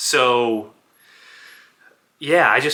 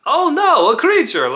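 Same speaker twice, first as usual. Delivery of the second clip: very high, loud hammed-up cry; mock alarm; 8 dB louder than usual. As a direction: squeal it in comic panic. Playing his character in mock alarm.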